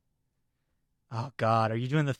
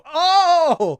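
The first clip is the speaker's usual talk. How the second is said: high, loud shuddering groan; loud cringing "oh"; 9 dB louder than usual. Retold or imitated. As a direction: let out a big high cringe. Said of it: "stand up and go".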